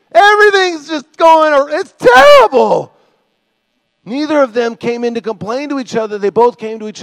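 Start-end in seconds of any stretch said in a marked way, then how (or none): 0.1-2.9 high, loud, hammed-up complaint; overwrought, drawn-out griping; mock despairing wail. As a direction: wail it as if all is lost. Mock complaining about the world.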